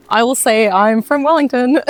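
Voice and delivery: usual speaking voice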